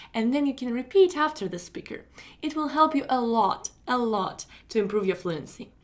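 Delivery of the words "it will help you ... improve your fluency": ordinary delivery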